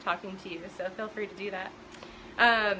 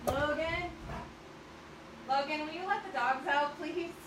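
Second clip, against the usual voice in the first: calling out; 6 semitones higher, 4 dB quieter than usual. Real, not acted. Calling son in another room.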